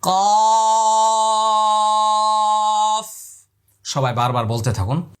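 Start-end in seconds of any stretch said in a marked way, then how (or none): 0.0-3.1 কফ from the tongue root, loud and held; Arabic: قاف مفخم; heavy qaf. Shown as a demonstration. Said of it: "আমি আবার বলছি".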